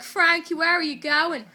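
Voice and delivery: loud retold call after someone; mock calling out. Retold boy calls after runaway.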